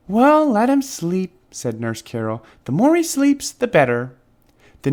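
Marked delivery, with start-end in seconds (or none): 0.1-1.3 high, slow, gentle nurse voice; lightly voiced kindly nurse; soothing nurse, higher and slower. A narrator's reading. 2.6-4.1 a hint of a higher nurse voice; lightly voiced kindly nurse. A narrator's reading. Nurse continues.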